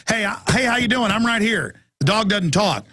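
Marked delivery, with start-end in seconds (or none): 0.1-1.8 sarcastic greeting. Retold or imitated. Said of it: "'cause I would say".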